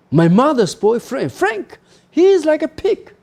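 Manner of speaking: put-on high-pitched little girl complaining; a child's pouting complaint; girl's voice. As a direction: read it as the sulky child. Girl's line quoted from novel.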